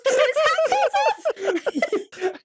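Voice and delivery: high, giggling retelling; laughing through it. Real, not acted. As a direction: tell it while cracking up.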